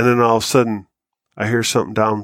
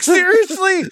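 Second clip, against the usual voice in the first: incredulous; slower than usual. Retold or imitated. Dad incredulous, teller laughing.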